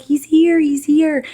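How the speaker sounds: high, loud joyful announcement; excited cry. Retold or imitated. Family bawling at the birth, calling out high and loud. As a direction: cry out that he's arrived.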